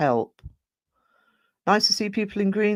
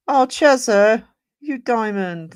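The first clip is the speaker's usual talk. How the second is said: higher and louder happy cry; delighted; 6 semitones higher, 8 dB louder, slower than usual. Real, not acted. Streamer thanking Chezza for her gift, delighted outburst. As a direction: gush over a kind viewer.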